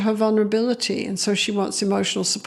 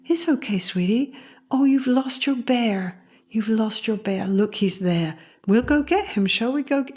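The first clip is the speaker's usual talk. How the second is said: put-on comforting sing-song to a small child; soothing mother. Mother turns to her upset kid, soothing. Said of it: "But listen to her voice".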